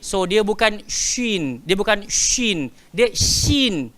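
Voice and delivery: "shin" slow, the wrong s sound set against the right sh from mid-tongue; wrong versus right shin. Shown as a demonstration. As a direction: contrast a sharp s with a true "shin" sh. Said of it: "Shin, cuba sebut".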